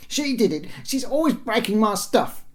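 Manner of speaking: put-on high, indignant child's voice; yelling young boy. A narrator's reading. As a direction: accuse your sister in a high boyish yell. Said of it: "he yelled".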